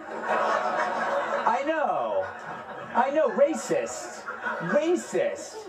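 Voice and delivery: high-pitched mock indignation at the crowd's groans; mock outrage. Playing along with audience outrage at joke.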